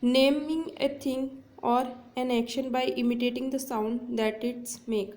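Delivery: very slow, word by word; slow dictation. Shown as a demonstration. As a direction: dictate the definition slowly. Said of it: "देखिए क्या होता है".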